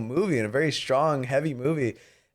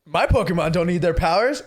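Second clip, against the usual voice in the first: mock-indignant challenge, pitched up at first; Mewtwo; 5 semitones higher than usual. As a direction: rise to the bait, taunting back. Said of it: "Mewtwo's like".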